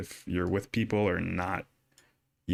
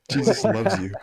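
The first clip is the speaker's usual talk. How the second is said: high, loud, laughing mock-evangelist; playful high-pitched send-up; mock preachy catchphrase; 12 semitones higher, 7 dB louder than usual. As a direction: put on a comically high, louder voice through a laugh.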